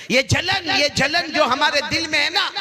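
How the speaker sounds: high, insistent sermon voice; impassioned preaching. Acted. Preacher warning congregation about envy in hearts, insistent. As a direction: hammer the warning at high pitch.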